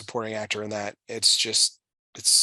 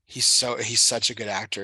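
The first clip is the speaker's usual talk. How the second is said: ordinary talk, nothing marked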